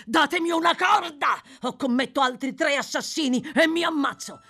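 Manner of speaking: high, loud, frantic threat; desperate demand. A narrator's reading. Murderer demands a rope from his companions, desperate shouting. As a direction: demand it as your last way out.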